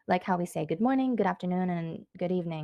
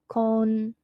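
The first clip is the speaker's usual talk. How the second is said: louder, higher "Konbanwa" in separate syllables; 4 semitones higher, 6 dB louder than usual. Shown as a demonstration. Teacher breaks down word for learners.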